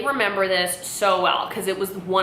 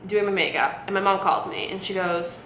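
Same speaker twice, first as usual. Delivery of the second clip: ordinary delivery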